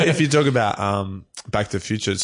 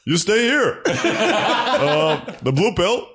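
lightly voiced Obama, high and laughing; a laughing, half-hearted Obama; Obama impression; 10 semitones higher, slower than usual. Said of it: "can you do The Matrix as, as Obama".

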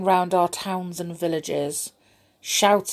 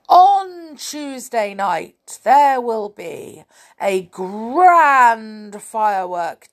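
slow, booming proclamation, much louder and higher; town crier shout; 4 semitones higher, 6 dB louder, slower than usual. A narrator's reading. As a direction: cry the news out across the square. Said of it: "shouted in his biggest, deepest voice".

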